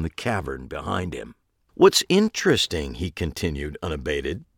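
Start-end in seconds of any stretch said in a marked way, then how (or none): 1.7-2.9 Pete, relishing killer. A narrator's reading. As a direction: list the killings with sick delight. Pete resumes.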